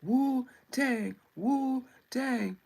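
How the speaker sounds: drawn-out mock-Chinese chant, each word falling; mock Chinese accent. Streamer recalling a movie line, mock Chinese accent. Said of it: "I remember a Wu-Tang".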